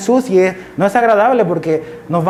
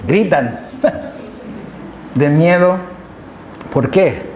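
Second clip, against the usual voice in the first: laughing; 3 dB quieter, slower than usual. Real, not acted. Laughs at the frightened fishermen.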